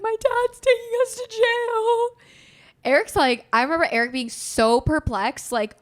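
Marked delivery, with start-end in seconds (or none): 0.0-2.1 high, loud wailing line; a little girl sobbing out the news; bawling child. Retold or imitated. Crying girl tells cousin.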